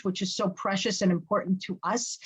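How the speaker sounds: ordinary talk, nothing marked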